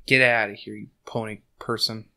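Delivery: ordinary delivery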